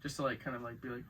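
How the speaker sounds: ordinary delivery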